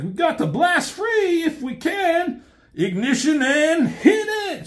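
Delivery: high shouted order to launch; high frantic cartoon pilot; urgent escape order. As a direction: yell to blast off before it's too late. Escaping in the shuttle.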